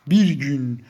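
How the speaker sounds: loud, forceful delivery; emphatic repeat. Real, not acted. Emphatic repeat of the same words. (yüksek sesle, vurgulu)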